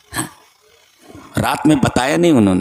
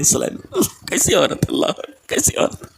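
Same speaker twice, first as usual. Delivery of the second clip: breathy fading whispers of wonder; soft reverent murmuring; awed whisper; 3 semitones higher, 4 dB quieter than usual. Real, not acted. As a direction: let it die away in hushed awe. Awed trailing whispers after climax.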